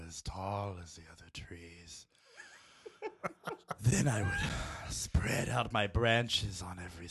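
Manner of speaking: low, slow breathy whisper with giggles; sexy voice. Shown as a demonstration. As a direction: seduce the listener in a hushed whisper. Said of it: "read the whole quote as sexy as possible".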